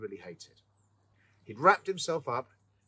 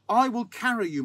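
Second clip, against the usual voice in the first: firm insistence; 10 semitones higher, 9 dB louder than usual. A narrator's reading. Sexton insists.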